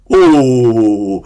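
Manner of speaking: long, low, falling "oooh"; awed "ooh". Acted. Preacher marvels at the prophecy to congregation, awed ooh. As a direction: draw out a sliding ooh of wonder. Amazed at the name's meaning.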